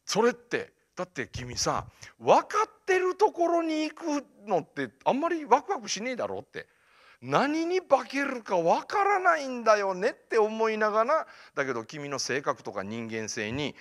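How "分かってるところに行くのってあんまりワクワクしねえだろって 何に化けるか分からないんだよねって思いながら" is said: high, emphatic enthusiasm; excited urging. Retold or imitated. Mentor urges student toward an unknown future, excited and high. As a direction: sell the thrill of not knowing.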